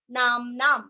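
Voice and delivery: ordinary delivery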